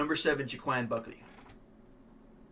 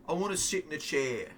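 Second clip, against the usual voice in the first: mock Gary voice; 3 semitones higher than usual. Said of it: "He might be like".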